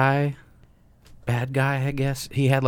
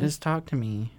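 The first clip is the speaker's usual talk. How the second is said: higher mocking echo of her words; mocking imitation; 6 semitones higher, 3 dB quieter than usual. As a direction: parrot her back, teasing. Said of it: "Stop yelling at me".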